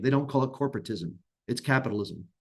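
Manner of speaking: ordinary talk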